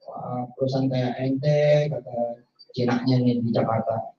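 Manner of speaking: ordinary talk, nothing marked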